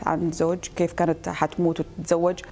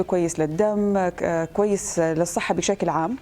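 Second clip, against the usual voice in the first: ordinary talk, nothing marked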